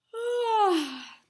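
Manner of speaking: long, high, loud drawn-out yawn; huge yawn. Acted. Diggory lazing in the dreamy wood, huge yawn. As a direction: yawn wide and slow, sleepy. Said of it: "with a huge yawn".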